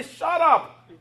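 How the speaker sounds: high, loud shout to silence someone; retold angry crowd. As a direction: imitate a crowd shouting him down. Crowd silencing the screaming man.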